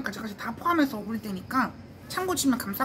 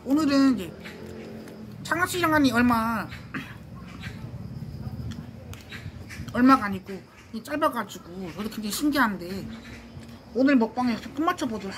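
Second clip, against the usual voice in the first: chewing while talking. Real, not acted. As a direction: mouth partly full. Seen 3: Bites snack at -1; mouth busy, later hand covers chewing mouth.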